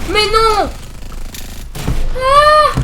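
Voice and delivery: a gamer caught in a firefight, yelping in panic; panicked yelps. Real, not acted. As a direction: yelp in real panic. Panicking mid-fight.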